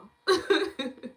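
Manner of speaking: bright burst of laughter; high laugh. Real, not acted. Laughs at herself.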